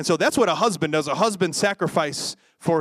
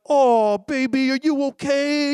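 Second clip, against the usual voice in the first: higher, hammed-up doting voice; mock coddling parent; 6 semitones higher, 3 dB louder, slower than usual. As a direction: coo over the child like a fussing mom. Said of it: "you don't wanna be like".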